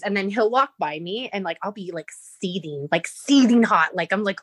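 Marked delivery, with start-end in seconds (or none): none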